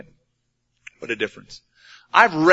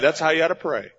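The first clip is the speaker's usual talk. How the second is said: normal talking voice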